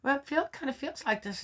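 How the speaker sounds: a hint of a hesitant child; daughter's voice. Daughter answers in retold dialogue.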